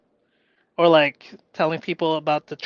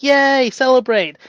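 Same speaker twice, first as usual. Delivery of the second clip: high, loud celebration; loud cheery yay; 7 semitones higher, 9 dB louder than usual. Retold or imitated. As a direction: cheer it like a win.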